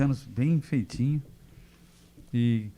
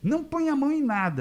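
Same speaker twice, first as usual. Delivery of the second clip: much higher urgent nurse voice; nurse's warning; 14 semitones higher than usual. Retold or imitated. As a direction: warn him off in a high nurse voice.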